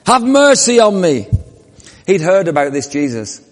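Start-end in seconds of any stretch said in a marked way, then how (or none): 0.0-1.3 loud, high plea for mercy; louder plea. Acted. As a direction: beg at full voice. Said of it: "even louder".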